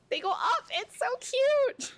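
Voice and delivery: They go up, squeaked high and sweet to the pet. Real, not acted. Baby talk to the pet.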